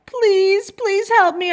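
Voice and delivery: high-pitched desperate plea; pleading boy. Acted. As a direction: beg like an upset child. Boy phones Mister Carp in distress.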